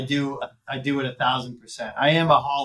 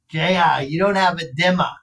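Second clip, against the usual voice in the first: imitating mom's Boston accent, raised pitch; mom's Boston accent, a touch higher; 4 semitones higher, 4 dB louder than usual. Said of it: "she's got a Boston accent".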